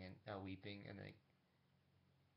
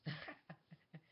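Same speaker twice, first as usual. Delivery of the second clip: short, higher-pitched put-on laugh; acted laugh; 7 semitones higher than usual. She kinda laughs at Steven's claim, acted laugh. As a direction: give a brief in-character laugh. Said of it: "she kinda laughs".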